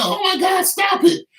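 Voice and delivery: very high mock female cry; panicked woman. Imitating the woman yelling at the fighters, shrill panic. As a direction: shriek it like she's horrified. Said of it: "You know".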